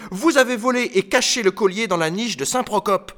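put-on high-pitched child's accusation; crying out accusingly. A narrator's reading. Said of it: "cria à son tour Bretelle". (accusation criée d'une voix aiguë)

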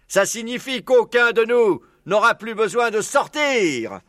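high, slow, emphatic announcement; rousing fox orator. Acted. Maître Renard promising safety to everyone, orating. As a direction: stretch it out for the big reveal.

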